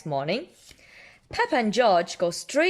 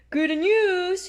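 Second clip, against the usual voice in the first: high, louder cheerful doctor; Mister Pony voice; 10 semitones higher, 5 dB louder than usual. Acted. Doctor giving Peppa the results, higher and louder. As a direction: announce it brightly.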